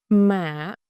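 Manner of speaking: "Mạ" said as mả, with a dip; "Mạ" in the dipping hỏi tone. Shown as a demonstration. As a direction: dip the pitch through "Mạ". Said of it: "It's a dipping tone".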